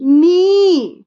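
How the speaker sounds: "नी" loud and high with a long ee; model for repeating. Shown as a demonstration. Mother has her child repeat knee, long loud ee. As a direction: stretch "नी" high for the child to echo. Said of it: "बोलो".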